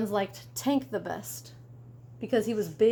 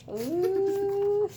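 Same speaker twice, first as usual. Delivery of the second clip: amused ooh; 10 semitones higher than usual. Real, not acted. Reacting to the joke.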